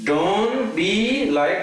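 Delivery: "Don't be like" molto lento, con enfasi su "be"; slow, drawn-out saying. Shown as a demonstration. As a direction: stretch it out slowly. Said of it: "this is my favorite one".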